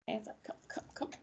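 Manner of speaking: hushed whisper to one student; whispered come come; whispered aside. Real, not acted. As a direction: quietly beckon a student over, almost no voice.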